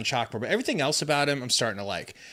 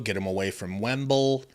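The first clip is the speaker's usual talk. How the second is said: ordinary talk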